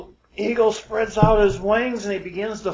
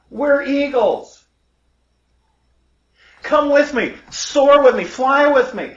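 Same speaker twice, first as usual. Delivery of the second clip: higher, louder eagle urging his brother on; rally him with a loud, lifted voice; exultant storyteller's call to take flight; rousing exhortation; 4 semitones higher, 5 dB louder than usual. Acted. Eagle urges brother to join him.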